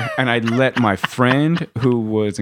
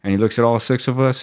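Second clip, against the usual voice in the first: usual speaking voice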